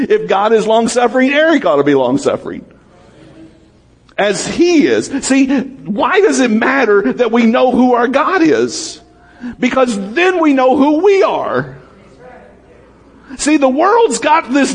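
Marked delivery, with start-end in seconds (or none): none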